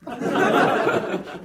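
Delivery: a high breathy laugh. Real, not acted. Narrator amused by his own punchline, laughing breathily. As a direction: laugh silently at the joke.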